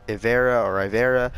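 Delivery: Ivera with "ver" in the middle; rejected pronunciations. Shown as a demonstration. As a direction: say Ivera with "vair" in the middle, two ways. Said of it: "not Ivera".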